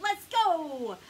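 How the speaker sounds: loud eager cry; rally the crew; yelled. A narrator's reading. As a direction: shipmate shouting to go. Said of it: "yelled a shipmate".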